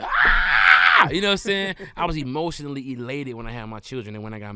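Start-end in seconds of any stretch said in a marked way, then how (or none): 0.0-1.1 retold happy shout, loud and high; excited yell. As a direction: let out a burst of joy. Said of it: "I was like".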